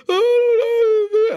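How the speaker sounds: high-pitched mock wailing; hammed-up frightened squeal; imitated panic. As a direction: imitate her helpless panic, voice way up. Imitating client flailing under cat attack.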